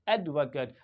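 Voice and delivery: "एडवोकेट" low, as AED-vuh-kuht; short 'cut' ending on "एडवोकेट", pitch falling; noun pronunciation. Shown as a demonstration. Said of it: "क्या पढ़ेंगे?".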